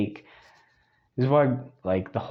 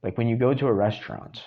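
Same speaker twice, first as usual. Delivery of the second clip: normal talking voice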